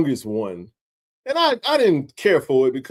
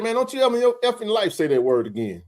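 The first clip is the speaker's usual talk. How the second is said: retold angry warning; 7 semitones higher than usual. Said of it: "I was hot".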